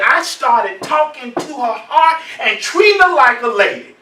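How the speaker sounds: loud, high, emphatic delivery; preacher building to a big punchline; triumphant report. Retold or imitated. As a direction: proclaim the breakthrough to the room. Triumphant retelling of mother's report.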